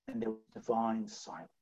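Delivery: very low, soft drop after the outcry; hushed. Real, not acted. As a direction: let it fall to a hush. Dramatizes silence after the call.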